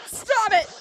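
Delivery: high loud playful yell; shouting. Acted. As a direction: shriek it in mock disgust. Yelling at a gross room.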